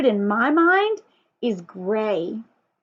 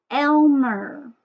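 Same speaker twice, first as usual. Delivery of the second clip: ordinary delivery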